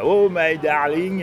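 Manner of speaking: put-on loud, slow English with a French accent; hint of Chevalier crooning with a French accent. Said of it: "il essayait pas de faire un accent anglais".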